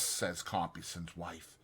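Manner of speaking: plain reading voice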